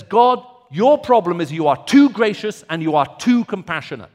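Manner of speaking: loud, rising preacher's fervour on Jonah's outrage; impassioned preaching. Acted. Preacher to congregation on Jonah's rage, loud and fervent. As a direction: build the outrage, pressing hard.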